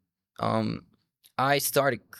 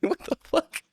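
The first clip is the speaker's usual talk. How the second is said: mock shocked students. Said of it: "students were looking at me".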